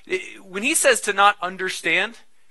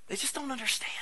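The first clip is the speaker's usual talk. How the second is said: whisper; 8 semitones higher, 9 dB quieter, faster than usual. Acted. Tender aside after loud plea.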